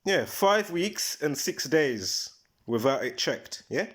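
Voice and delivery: ordinary talk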